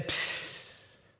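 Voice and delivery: soft slow breathy psst of a bus door; door hiss. Retold or imitated. Telling the congregation how the tour bus door hissed open. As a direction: imitate the door sighing open.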